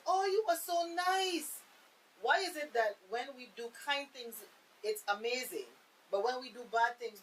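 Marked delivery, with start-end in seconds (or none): 0.1-1.6 higher, slow mock surprise; mock amazement. Speaker imitating people shocked by kindness, mock surprise. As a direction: stretch it out like a hammed-up shocked onlooker. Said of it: "It's like".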